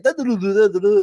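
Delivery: high-pitched mock-French gibberish. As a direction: run syllables together as if imitating French, endings dropped. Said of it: "French, they just leave stuff off the same way".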